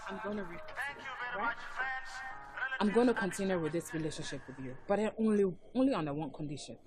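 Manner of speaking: low, very soft, measured voice; calm, subdued negotiation; quiet conceding. Acted. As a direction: lay down terms in a hushed, low voice. Reluctantly offers conditional reprieve.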